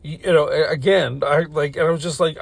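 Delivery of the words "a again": ordinary delivery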